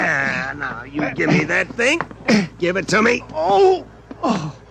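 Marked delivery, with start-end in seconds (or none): 3.3-3.9 pained cry. Acted.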